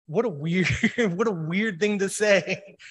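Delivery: amused disbelief. Real, not acted. Speaker puzzling over Job's odd reply, amused disbelief. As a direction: laugh at the oddness of it.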